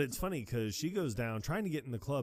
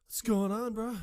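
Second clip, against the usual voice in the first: high bro greeting; 11 semitones higher, 5 dB louder than usual. Retold or imitated. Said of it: "he's like".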